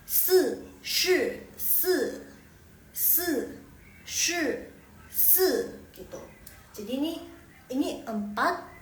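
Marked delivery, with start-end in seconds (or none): 0.1-5.5 "si, shi, si" each high and falling sharply; fourth-tone drill. Shown as a demonstration. Teacher demonstrates the tongue twister, all in falling fourth tone. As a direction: keep every "si" and "shi" separate, high then dropping. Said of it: "Ini semuanya nada keempat".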